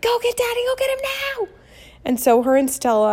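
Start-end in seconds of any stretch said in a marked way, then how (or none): 0.0-1.5 much higher, frantic cry for help; panicked yell. Retold or imitated. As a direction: shriek at the kid to fetch Daddy. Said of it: "I am screaming at the top of my lungs".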